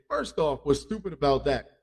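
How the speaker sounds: usual speaking voice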